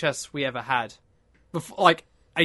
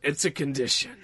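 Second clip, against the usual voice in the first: low gruff tough-guy impression; lightly gruff mock action star; Steven Seagal; 2 semitones lower, 3 dB quieter than usual. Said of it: "in a really low, gruff voice".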